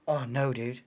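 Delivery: ordinary delivery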